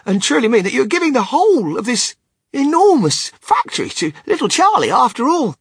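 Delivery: high, loud incredulous question; amazed old grandpa, voice pitched right up; astonished stammer. A narrator's reading. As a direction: ask it in loud, overwhelmed disbelief. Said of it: "stammered Grandpa Joe".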